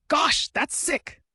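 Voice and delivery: high, slow, stretched-out gasp of awe; amazed cry. Acted. Comrade hearing of a fourth general, amazed and high. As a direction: let it soar, drawn out.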